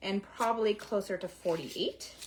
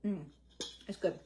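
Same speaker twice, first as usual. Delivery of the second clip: tasting off spoon; 2 semitones lower, 6 dB quieter than usual. Real, not acted. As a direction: mouth full of batter. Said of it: "let me taste the batter".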